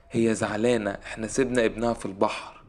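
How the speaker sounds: usual speaking voice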